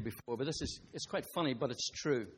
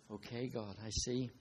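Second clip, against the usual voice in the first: soft and much quieter, ashamed; humbled quiet; 6 semitones lower, 7 dB quieter than usual. Retold or imitated. Preacher humbled before God, speaking quietly. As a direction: drop the voice, chastened before God. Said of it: "And I am embarrassed".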